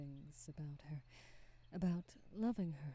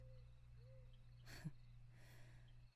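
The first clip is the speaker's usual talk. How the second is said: soft wistful laugh; 15 dB quieter than usual. Acted.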